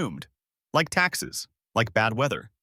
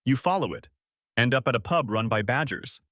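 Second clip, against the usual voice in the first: usual speaking voice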